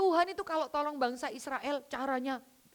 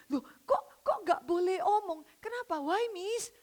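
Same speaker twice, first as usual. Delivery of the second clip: put-on curious child; 7 semitones higher than usual. A child asks, higher pitch.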